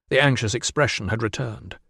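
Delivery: ordinary delivery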